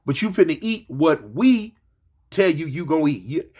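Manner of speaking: ordinary talk, nothing marked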